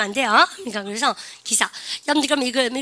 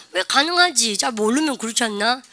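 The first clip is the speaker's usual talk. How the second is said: usual speaking voice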